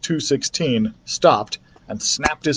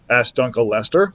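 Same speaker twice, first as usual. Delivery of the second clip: plain reading voice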